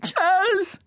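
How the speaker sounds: very high, short shriek of a name. A narrator's reading. Debs shrieking at Chaz, high shriek. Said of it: "shrieks Debs".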